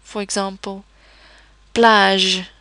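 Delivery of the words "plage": plage ending on a long "zh"; soft French G. Shown as a demonstration. Teacher giving example, loud plage with zh ending. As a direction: end plage with a French J sound. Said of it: "pronounced as the French J sound".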